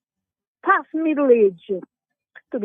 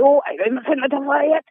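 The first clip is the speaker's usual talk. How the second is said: mimicking the boss; 4 semitones higher than usual. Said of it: "and he said".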